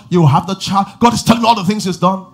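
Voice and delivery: loud, high, driving preacher's build; impassioned sermon climax, rising to a shout; full-force preaching. Real, not acted. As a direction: preach it hard and rising to the crowd. Preaching burst.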